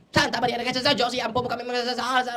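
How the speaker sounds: loud, high, hurried begging, breaking into a laugh; frantic pleading. Retold or imitated. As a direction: plead desperately for help. Frightened people pleading for prayer. (nagmamakaawang sigaw)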